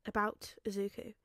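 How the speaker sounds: ordinary delivery, nothing marked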